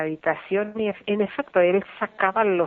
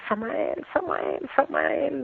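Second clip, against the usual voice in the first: silent desperate calling; 5 semitones higher, 5 dB quieter, slower than usual. Retold or imitated. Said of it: "empecé a gritar así mentalmente".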